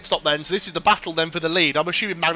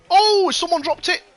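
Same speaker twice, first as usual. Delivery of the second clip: surprised; 14 semitones higher, 7 dB louder than usual. Real, not acted. Sudden incident on the track.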